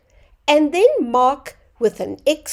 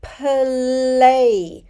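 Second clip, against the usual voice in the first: "Play" drawn out as "puh-lay"; slow teaching "Play"; said slowly; 4 dB louder than usual. Shown as a demonstration. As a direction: stretch "Play" so the P and L separate. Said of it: "Let's say it slowly".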